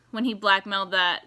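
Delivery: normal talking voice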